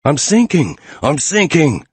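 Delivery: sinking in quicksand, crying out; frantic repeated shouts; panicked screaming. A narrator's reading. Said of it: "I screamed".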